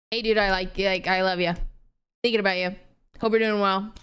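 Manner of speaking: low, understated acted affection; casual guy friend.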